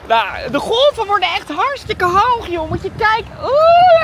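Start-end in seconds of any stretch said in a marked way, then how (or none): 3.4-4.0 high loud ooh at the cold; cold gasp. Real, not acted. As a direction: yelp at a cold wave. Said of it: "het is zo koud". (hoge kreet van de kou)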